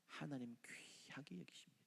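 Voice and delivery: breathy, hushed and intimate; whisper. Real, not acted. Preacher whispers to the congregation about God's love. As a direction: lean in and whisper.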